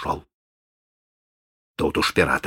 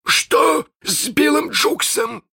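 hissed; slower than usual. Acted. Hook demands news of Jukes, slow and menacing. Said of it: "прошипел Крюк".

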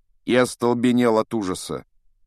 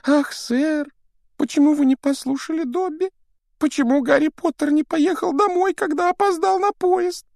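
high, squeaky pleading elf; house-elf voice; 6 dB louder than usual. Acted. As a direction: play Dobby the anxious house-elf. Dobby the house-elf speaks. (тонкий голос домового эльфа)

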